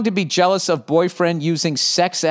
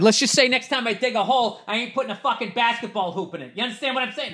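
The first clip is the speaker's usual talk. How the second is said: higher, hushed defiant threat; high hushed mock bravado; mock defiant boast; 7 semitones higher, 5 dB quieter, faster than usual. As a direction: mutter it like a bluff you won't keep. Mock tough-guy threat, then retracted.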